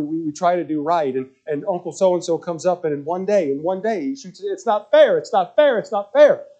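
put-on a girl crying it's not fair at her dad; crying complaint.

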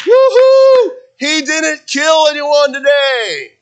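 high, loud whoop, then drawn-out cheer; hammed-up, over-the-top jubilant shout; mock celebration. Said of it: "God's not up in heaven going".

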